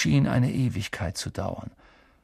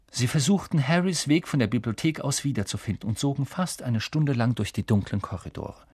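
plain reading voice

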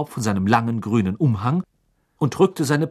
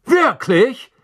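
high, excited fatherly voice; eager wizard dad voice; 9 semitones higher, 4 dB louder than usual. A narrator's reading. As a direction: play a curious, cheerful father. Mr Weasley voiced as character.

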